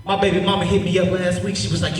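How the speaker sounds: ordinary delivery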